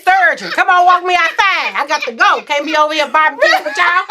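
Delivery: high, loud urging, half laughing; laughing high demand; pushy hurry. Retold or imitated. Hurrying husband to walk her.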